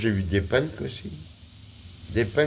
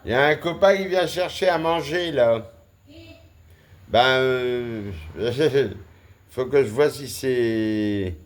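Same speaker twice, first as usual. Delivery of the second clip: higher, louder commentary; animated; 7 semitones higher, 4 dB louder than usual. Real, not acted. Vlogger announcing a cat come looking for food, animated. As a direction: perk up, voice raised and lifted.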